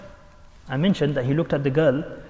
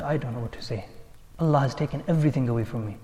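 soft, lowered reply of a broken man; subdued answer; 4 semitones lower, 7 dB quieter than usual. Retold or imitated. Broken Shaykh answering his followers, subdued. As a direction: answer quietly, as if all is lost.

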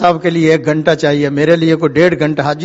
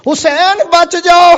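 high-pitched urgent cry; acted warning call from afar; 12 semitones higher, 3 dB louder, slower than usual. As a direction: call it out far above your speaking pitch. Repeats the warning, calling out.

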